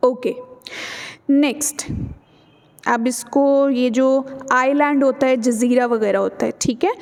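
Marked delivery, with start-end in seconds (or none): none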